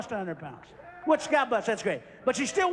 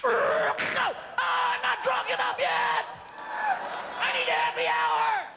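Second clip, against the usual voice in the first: put-on drunk yell; 10 semitones higher, slower than usual.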